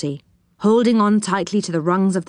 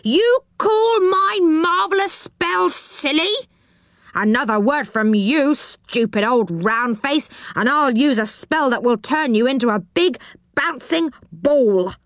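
goblin in a rage; 6 semitones higher than usual. A narrator's reading. Goblin raging at Moonface for insults. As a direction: huff with offended fury. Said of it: "cried the goblin in a rage".

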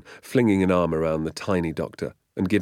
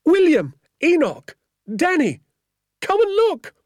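high, loud shouts of names; calling out; 9 dB louder than usual. A narrator's reading. Woman summoning the men into the workshop, excited shouting. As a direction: summon each one with a high, urgent call. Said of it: "called from inside the workshop".

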